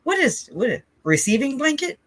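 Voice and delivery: as if the word made no sense at all; squeaky puzzled what; confused high-pitched disbelief. Retold or imitated. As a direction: voice jumps up in baffled surprise. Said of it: "I was like".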